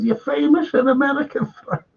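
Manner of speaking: high-pitched excited call from a mate; excited lad quoting. Retold or imitated. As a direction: pitch it up like a buzzing mate breaking the news. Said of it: "on and said".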